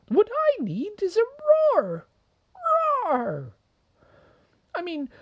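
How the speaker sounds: very high, quick and keen mouse voice; a tiny mouse dreaming big; eager little mouse. Acted. Mouse's long speech.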